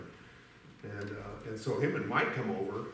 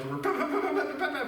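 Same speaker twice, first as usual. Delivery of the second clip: quick high "pep pep" shot sounds; imitated rifle shots; 14 semitones higher than usual. Going through shells at geese, high mock gunfire. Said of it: "we're going through shells".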